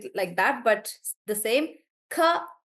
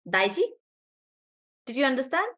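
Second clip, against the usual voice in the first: ordinary talk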